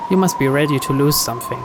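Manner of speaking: plain reading voice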